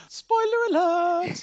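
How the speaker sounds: high, loud, drawn-out playful cry; playful, stretched-out high holler; mock alarm. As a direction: sing out a mock warning, long and high. Playful warning after teasing remark.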